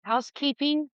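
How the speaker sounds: high, hollow ghost call; ghostly housekeeping call. Acted. But make it ghostly.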